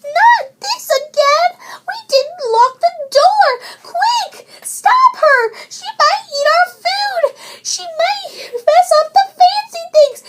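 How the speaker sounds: high, loud frantic shouting; high baby bear shouting in alarm; frantic cub. A narrator's reading. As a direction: rush it out in pure panic.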